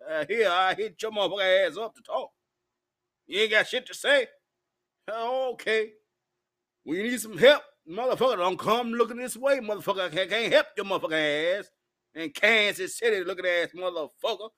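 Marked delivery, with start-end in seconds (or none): none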